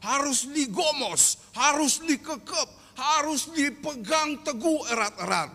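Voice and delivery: high, loud, forceful sermon delivery; fervent preaching. Acted. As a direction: drive the point home from the pulpit. (khotbah berapi-api dengan suara tinggi)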